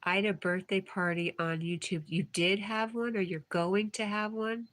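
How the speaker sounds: ordinary delivery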